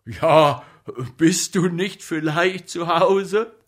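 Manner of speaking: loud, high incredulous question; impatient, loud comeback; exasperated retort. A narrator's reading. Comic answers him in exasperation.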